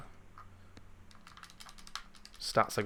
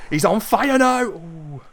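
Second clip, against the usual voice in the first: much higher, louder hype call; excited call; 9 semitones higher, 6 dB louder, slower than usual. Acted. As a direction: shout his hot streak like a commentator. Commentary burst.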